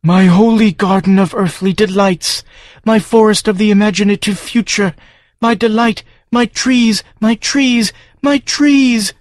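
high, far-off alien creature voice wailing over its trees; keen like a grieving monster; distraught farm creature. Acted. The farm mourning its trees before Joe, high wailing creature. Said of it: "the distraught farm".

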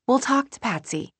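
hint of break. May promising to fix the cold room, voice almost breaking. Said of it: "a hint of break in her voice".

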